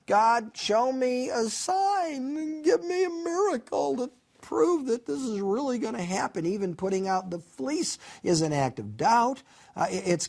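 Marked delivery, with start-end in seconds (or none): 0.1-6.4 mock pleading, pitched up; doubtful pleading. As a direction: beg for proof like a doubter. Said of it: "constantly marked by doubt and uncertainty".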